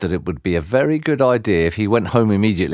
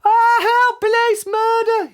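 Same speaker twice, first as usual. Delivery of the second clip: very high, loud squeaky scream; 3 dB louder, slower than usual. A narrator's reading. Mister Jelly hit by a falling leaf, screaming. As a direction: scream for help in panic. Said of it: "he screamed".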